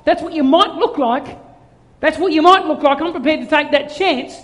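high, loud sermon at full force; impassioned preaching. Real, not acted. Preacher telling of God's joy and enthusiasm, impassioned preaching. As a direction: drive it home with fervour.

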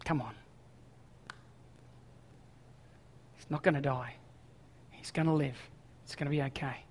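hushed, weary appeal with long pauses; quietly exasperated, voice kept low; mildly frustrated. Acted. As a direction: plead softly, a touch frustrated. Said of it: "a little bit frustrated".

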